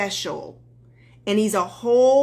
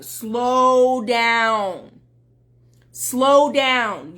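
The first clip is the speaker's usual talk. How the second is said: drawn-out, loud caution; calming her down; 5 dB louder, much slower than usual. Retold or imitated. Coach reining in an excited woman, firm calming down. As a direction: stretch the words to rein someone in. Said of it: "really calm her down".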